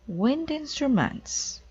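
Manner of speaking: high, slow, thrilled; delighted discovery. Acted. Amelia finding wind instruments, excited and slow. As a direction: savor the find with glee.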